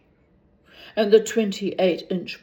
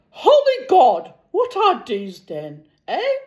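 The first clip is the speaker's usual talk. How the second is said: loud, high cry of horror; shocked outburst; 8 semitones higher, 7 dB louder than usual. Acted. Milligan aghast at his own legs. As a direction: blurt it out in horror.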